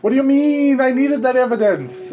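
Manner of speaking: high, loud exasperation; king scolding the general; frustrated outburst. Acted. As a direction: snap in frustration. Angry at general's report.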